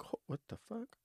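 stunned disbelief. Retold or imitated. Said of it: "I'm looking at him like".

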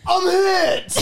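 high shouted Boston accent. Shown as a demonstration. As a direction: yell it like a wounded Bostonian soldier. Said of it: "I'm gonna need it in a Boston accent".

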